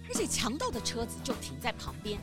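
plain reading voice

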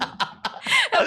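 high burst of laughter; shrieking laugh. Real, not acted. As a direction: crack up at the idea. (高声爆笑)

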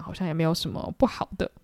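normal talking voice

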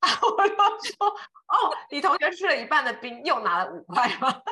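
can barely get the words out for laughing; laughing while speaking. Real, not acted. Retelling her daughter's ice cream story, laughing through it.

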